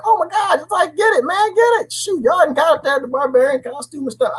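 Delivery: high-pitched, giddy cheering-on; excited. Retold or imitated. Host retelling first reaction to the barbarian, excited. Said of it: "I was excited".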